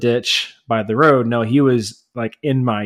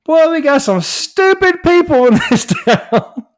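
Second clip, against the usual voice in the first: much higher, louder, slow yell breaking into a laugh; yelled to neighbor; 5 dB louder than usual. Retold or imitated. Yelling across the street to his neighbor, laughing yell. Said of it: "I yelled over to my neighbor".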